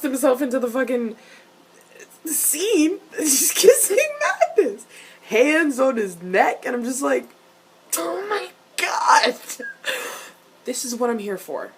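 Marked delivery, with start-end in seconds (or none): 2.3-4.9 high, loud excited squeal; real giddy high-pitched outburst; fangirl gushing. Real, not acted. Fan gushing over kiss scene. 8.0-9.3 high, slow, loud squeal; drawn-out high-pitched shriek of delight; fangirl freak-out. Retold or imitated. Reacting to the kiss scene.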